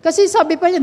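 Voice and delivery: ordinary delivery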